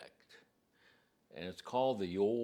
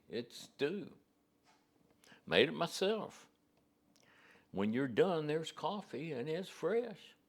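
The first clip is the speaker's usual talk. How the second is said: put-on kindly old George in a Southwest accent, pitched up; 6 semitones higher than usual. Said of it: "kind of Southwest accent here".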